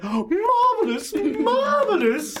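put-on high, slow, breathy old lady; Duchess voice. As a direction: gush like a batty old duchess. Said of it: "the Duchess is like".

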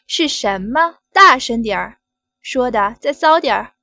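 loud, pushy order; demanding shout. Acted. Boss demanding louder.

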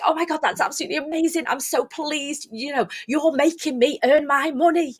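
high, hammed-up teacher gushing; over-the-top excitement. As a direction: gush at the class with huge energy. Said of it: "I go proper over the top".

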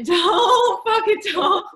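cracking up while waving it off; laughing. Real, not acted. Laughing off a boast.

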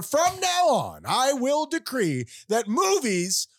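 high, slow proclaiming shout; royal decree. Acted. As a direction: declare it like a king.

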